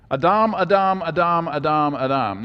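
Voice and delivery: "Adam" as ah-DAHM, stressed on the second syllable; chant-like repeats of "Adam" with a long final AH; Hebrew pronunciation. Shown as a demonstration. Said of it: "that's how you pronounce it in Hebrew".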